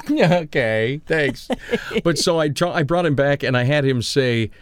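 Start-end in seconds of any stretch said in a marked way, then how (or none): none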